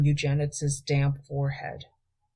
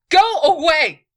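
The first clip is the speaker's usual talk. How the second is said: loud, much higher angry snap; snapping; 13 semitones higher, 11 dB louder than usual. A narrator's reading. Queen snaps at Galen at the door, angrily. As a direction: bark it at the intruder. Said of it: "she snapped".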